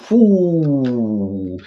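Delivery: drawn-out imitated power-up whoo; long falling whoosh. Fan showing a villain powering up, long whoosh. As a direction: let a long 'whoo' slide down.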